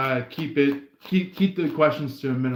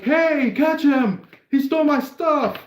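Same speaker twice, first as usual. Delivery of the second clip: calling out; 10 semitones higher, 4 dB louder than usual. Acted. Theft victim begs others to catch him, high calling out. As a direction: call after a thief, pitched up.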